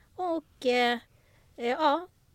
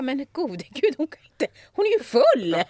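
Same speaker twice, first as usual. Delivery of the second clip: louder, higher shocked exclaim; shocked realisation; 4 semitones higher, 6 dB louder than usual. Retold or imitated. Crew realising Pamela is drunk, louder shocked exclaim. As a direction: gasp in disbelief.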